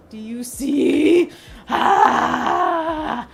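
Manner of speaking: slow, loud, high "Do you see?"; excited shout. Real, not acted. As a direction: call it out loud in delight.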